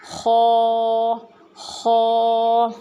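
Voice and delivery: خو with rounded lips, scraped from mid throat; correct kho. Shown as a demonstration. Teacher correcting children who say کھا to خو. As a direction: round the mouth and rasp خو. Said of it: "حلق کے درمیان کے حصے سے".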